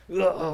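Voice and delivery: loud, slow hammed-up groan of rising anger; mock angry growl. God about to lose his temper, mock angry growl. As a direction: let out a playful frustrated roar.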